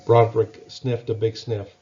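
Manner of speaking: ordinary delivery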